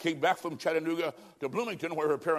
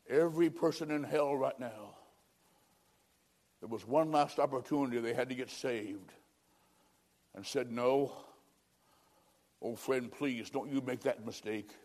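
low, soft, grave appeal; solemn plea; 4 semitones lower, 6 dB quieter than usual. Real, not acted. Preacher begging a friend not to make that mistake, hushed and solemn. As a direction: drop down and plead quietly with long pauses.

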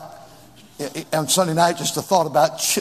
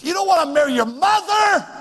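much higher, incredulous appeal; exhorting; 9 semitones higher than usual. Real, not acted. Preacher challenging boys about marrying their mother, high exhortation. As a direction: cry it out high to the crowd. Preacher calling out the young men.

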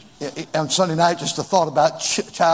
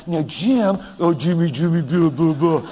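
mock baby talk. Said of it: "Can you imagine Nanette".

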